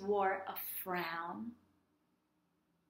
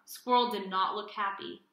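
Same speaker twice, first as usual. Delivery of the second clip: ordinary delivery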